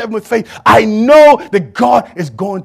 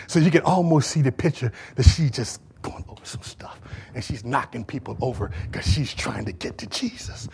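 whispering; 9 semitones lower, 11 dB quieter than usual. Acted. Preacher keeps whispering.

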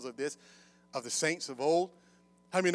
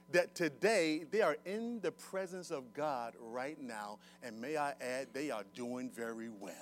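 soft, measured, lowered pastoral voice; gentle reassurance; 2 semitones lower, 6 dB quieter than usual. Real, not acted. Preacher to those who lost loved ones, softly reassuring. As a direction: console them quietly and let it settle. Consoles the bereaved.

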